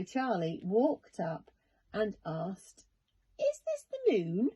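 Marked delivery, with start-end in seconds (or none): 3.4-4.5 put-on high, small child voice asking; boy's voice. A narrator's reading. Charlie asking the strange creature, high boy voice. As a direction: ask like a curious little boy.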